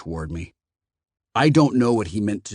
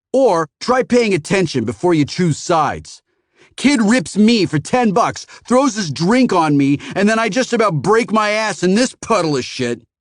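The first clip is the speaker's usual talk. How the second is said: much higher, louder complaining rant; angry rant; 9 semitones higher, 6 dB louder than usual. A narrator's reading. Phoenician rants at Matt about the kid, angry. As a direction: rant about his grievances.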